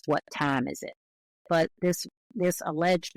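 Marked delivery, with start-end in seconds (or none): 0.0-0.9 "time" with "ah" in place of "eye"; Southern vowel in time. Shown as a demonstration. Linguist citing a defining Southern feature, "time" as "tahm". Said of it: "chances are very high you have this".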